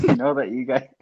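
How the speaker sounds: giggling through words; can't stop laughing. Real, not acted. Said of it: "retry while laughing".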